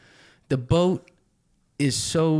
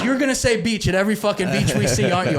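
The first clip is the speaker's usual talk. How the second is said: exasperated; 8 semitones higher, 7 dB louder, faster than usual. Retold or imitated. Annoyed guide quoted.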